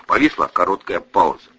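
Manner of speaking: usual speaking voice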